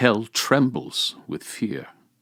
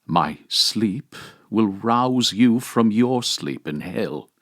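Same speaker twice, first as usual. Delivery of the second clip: normal talking voice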